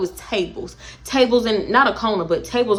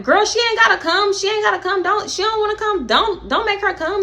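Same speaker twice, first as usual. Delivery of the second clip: dismissive; 10 semitones higher than usual. Retold or imitated. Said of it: "Dream is telling Dime".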